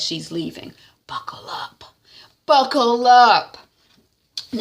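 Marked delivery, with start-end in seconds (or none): 1.1-1.8 unvoiced menacing whisper; whispered threat. Hadisa leaving, whispering a threat to TY. 2.5-3.6 slow, loud, higher menacing call; loud threat. Retold or imitated. Hadisa leaving, booming a slow threat at TY.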